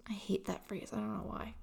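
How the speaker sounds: hushed, breathy whisper of a grumble; whispered under the breath; whispered aside. Real, not acted. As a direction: drop to a whisper as if confessing a pet peeve. Aside about a disliked phrase.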